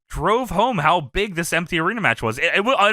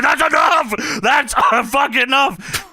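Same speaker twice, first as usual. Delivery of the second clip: higher, louder "enough"; mock shout; 8 semitones higher, 4 dB louder than usual. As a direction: shut them down like a fed-up giant. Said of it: "He said".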